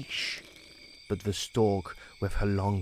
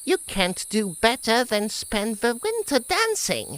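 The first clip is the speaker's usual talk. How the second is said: high, louder smug retort; mocking ants; 11 semitones higher, 5 dB louder than usual. Ants dismissing the grasshopper, high smug retort. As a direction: deliver the punchline with scorn.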